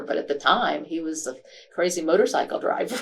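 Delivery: usual speaking voice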